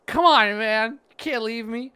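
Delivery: high loud emotional cry; anguished plea. Real, not acted. Player begging his friend Ivor to stay, loud anguish. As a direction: cry out, heartbroken.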